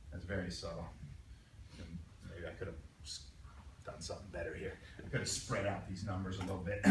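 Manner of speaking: low, hushed aside while working; murmured second thoughts about your own work; muttering to oneself. Real, not acted. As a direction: think aloud quietly, barely projecting. Muttering to himself over his drawing.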